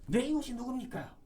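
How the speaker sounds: high, loud indignant demand; angry retort. Acted. Elders challenging Jesus, high indignant shout. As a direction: snap it back like an offended scholar. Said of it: "이런 식으로 화를 내요".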